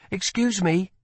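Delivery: put-on a curious young woman asks; girl's voice. A narrator's reading. Lila cuts in.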